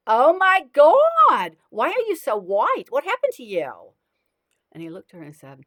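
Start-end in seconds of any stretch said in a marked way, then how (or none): 0.1-1.6 very high, very loud and slow; "Oh my God" dragged out at full volume, way up high; drawn-out horrified shriek. Acted. Woman repeats, even bigger. 1.7-3.9 high and loud, aghast; brash beachgoer woman questioning him loudly; loud shocked woman. Acted. Woman questions pale man.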